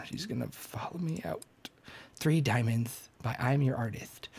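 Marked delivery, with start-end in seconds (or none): 0.1-1.4 soft unvoiced whisper. Real, not acted. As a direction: whisper it secretly. Whispered aside.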